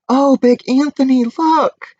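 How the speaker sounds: high, loud alarmed nuns' cry; alarmed sisters. Acted. Nuns call out in alarm to Big Anthony, high and loud. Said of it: "the sisters from the convent said".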